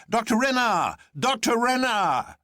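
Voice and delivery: high, loud hail across the road; calling out. A narrator's reading. Bull hailing Doctor Renard from the car, calling out high. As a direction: call his name across the gap. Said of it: "calling out".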